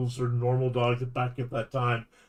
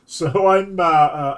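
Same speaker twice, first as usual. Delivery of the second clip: usual speaking voice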